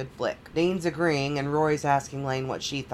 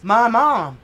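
raised, louder retold exclamation; mock alarm; 6 semitones higher, 7 dB louder than usual. Daughter spots her yelling mother, mock alarm. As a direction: blurt it high, imitating a caught daughter.

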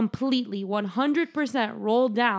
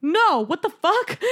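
mock doctor outburst; 6 semitones higher, 4 dB louder, slower than usual. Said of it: "the doctor's like".

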